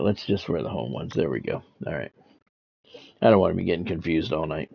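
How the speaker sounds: ordinary delivery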